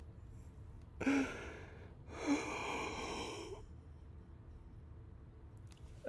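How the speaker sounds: long breathy sigh of despair; real weary sigh; long shaky sigh. Real, not acted. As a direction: let a long ragged breath out.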